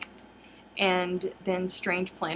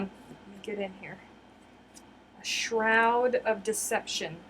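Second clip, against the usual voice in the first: ordinary delivery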